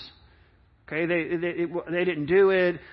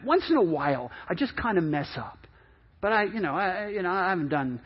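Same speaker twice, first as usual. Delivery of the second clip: sheepish excuse; 3 semitones lower than usual. Retold or imitated. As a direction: play it down like a mild slip-up. Voicing churchgoer's excuse.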